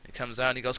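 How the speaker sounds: usual speaking voice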